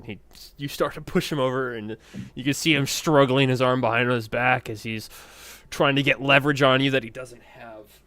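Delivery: loud, driving play-by-play; game master narration. A narrator's reading. Game master narrating an arm-wrestling struggle, loud animated narration. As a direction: push the voice through the struggle.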